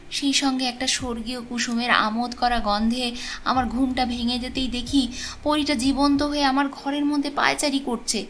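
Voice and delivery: ordinary delivery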